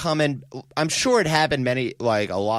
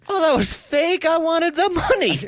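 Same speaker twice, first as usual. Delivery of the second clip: high-pitched comic outcry; hammed-up, much higher voice of someone duped; mock dismay; 4 dB louder than usual. Playing someone duped by the fake call, mock dismay.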